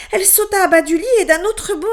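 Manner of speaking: ordinary delivery, nothing marked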